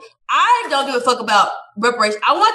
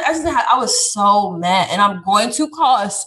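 ordinary delivery

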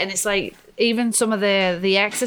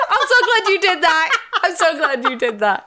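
high, laughing delight; cracking up while talking, pitched high; real laughter; 9 semitones higher, 4 dB louder than usual. Real, not acted. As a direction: say it through a big laugh. Cracks up at the slogan joke.

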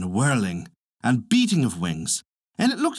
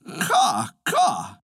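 cawing like a crow, second caw higher; crow's caw; 3 semitones higher than usual. A narrator's reading. The crow answers the frog, cawing. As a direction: give two crow calls, jumping up on the second. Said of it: "But the crow said".

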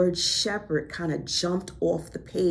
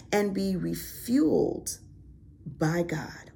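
impassioned preaching; 3 semitones higher than usual. Acted. Preacher encouraging listeners to rest, passionate preaching. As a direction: preach it with conviction and pauses.

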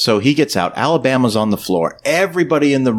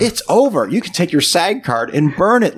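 high-pitched, indignant retold threat; mock fury; 8 semitones higher than usual. Boss ends Elliot's acting career, mock fury. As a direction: act out an angry boss's dismissal, pitched up. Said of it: "He is so mad at Elliot".

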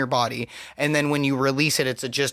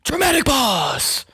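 announcer voice; 9 semitones higher, slower than usual. Acted. Announcing co-host title.

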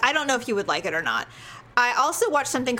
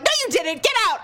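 high, exasperated order; sharp retort building to a raised-voice command; angry dismissal; 9 semitones higher, 3 dB louder than usual. Retold or imitated. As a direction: re-enact throwing someone out of the room. Retold ordering husband out.

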